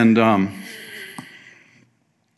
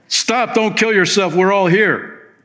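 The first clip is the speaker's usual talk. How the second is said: higher, urgent shout to stop him; urgent call; 7 semitones higher than usual. Retold or imitated. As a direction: call out to save a life. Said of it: "Paul shouts".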